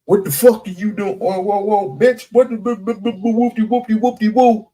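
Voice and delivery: high, fast hammed-up cussing; mock aggressive. Acted rude version of the confrontation.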